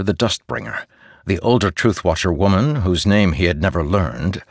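plain reading voice

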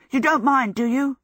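high, anxious, hurried plea; agitated. A narrator's reading. Michael grabbing bread on his way out, agitated. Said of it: "he said in an agitated way".

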